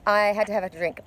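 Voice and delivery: ordinary talk, nothing marked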